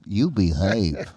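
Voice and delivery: teasing. Real, not acted. Teasing reply to innuendo.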